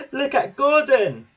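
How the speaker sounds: high, loud teasing shout; children crying out. A narrator's reading. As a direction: jeer like delighted children. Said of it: "they cried".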